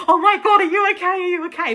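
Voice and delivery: mock panic. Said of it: "I would have been like".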